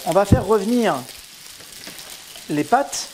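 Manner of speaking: hammed-up southern French accent. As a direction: sing out "On va faire revenir" in a Marseille-style southern French accent. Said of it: "je vais prendre mon accent du Sud".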